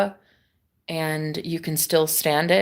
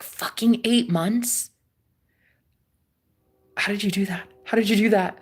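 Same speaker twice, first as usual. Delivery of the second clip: imitating someone's disbelief, slightly raised pitch; mild retold shock. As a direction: retell his astonished questions with mild incredulity. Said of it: "he was so shocked".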